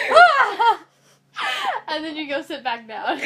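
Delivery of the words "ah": high, loud laughing shout; mock battle yell. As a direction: let out a silly war cry. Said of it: "and you just go".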